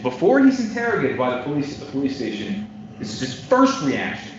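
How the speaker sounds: ordinary talk, nothing marked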